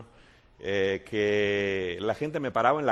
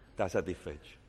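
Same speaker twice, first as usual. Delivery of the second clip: soft echo; 7 semitones lower, 7 dB quieter than usual. Acted. Preacher repeats softly for effect.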